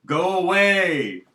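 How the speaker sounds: high, loud, slow shouted quote; hammed-up shout of "go away" from the tribe; mock German shout. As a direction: bellow it slowly like a furious tribe. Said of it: "the Germans were like".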